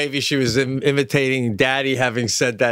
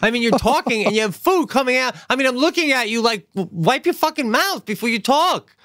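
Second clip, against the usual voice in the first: high, incredulous nagging; scolding wife; 10 semitones higher than usual. Retold or imitated. Wife telling husband off about food on his lips, scolding. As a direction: needle him in a higher voice. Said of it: "She goes".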